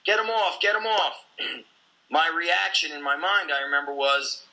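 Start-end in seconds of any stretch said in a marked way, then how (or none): 0.0-1.2 mild imitation of an urgent scream; retold scream. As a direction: imitate someone screaming for help, volume held back. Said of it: "He screams".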